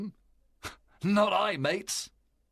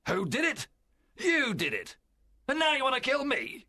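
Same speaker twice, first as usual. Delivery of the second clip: high-pitched, forceful angry retort; indignant captain in a strong voice; 10 semitones higher than usual. Acted. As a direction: throw the blame back at the crew. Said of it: "Silver was very angry, and his voice was strong".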